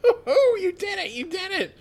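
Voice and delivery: high, delighted cheering; excited cheer. Acted. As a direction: cheer like proud friend. Krillin cheering.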